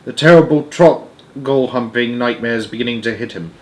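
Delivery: ordinary delivery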